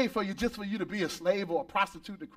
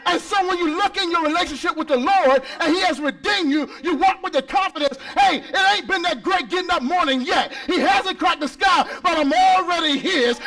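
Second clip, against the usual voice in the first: higher and louder, punching each phrase; preaching at full force; 5 semitones higher, 4 dB louder than usual. Real, not acted. Pastor preaching full force about redemption to the church. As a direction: preach it hard to the congregation.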